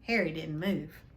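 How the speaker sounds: usual speaking voice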